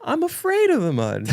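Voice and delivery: high falsetto teasing; mock scaredy voice. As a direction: tease with a squeaky, babyish fear. Mocks co-host's fear of mud.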